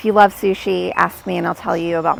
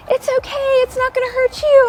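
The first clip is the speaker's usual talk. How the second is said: put-on much higher, coaxing comfort for a crying baby; calm the frightened little one; mother hushing her crying toddler, pitched way up; high soothing to baby; 6 dB louder than usual.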